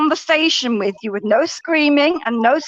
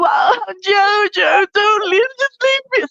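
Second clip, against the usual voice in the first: high mock tearful whine; hammed-up fake sobbing plea; fake crying; 5 semitones higher, slower than usual. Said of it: "See, I can do that as well".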